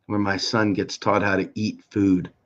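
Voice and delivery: normal talking voice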